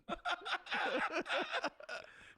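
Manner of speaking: long, high laughing fit; drawn-out, high laughter. Real, not acted. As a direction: crack up at your own punchline. Cracking up at own punchline.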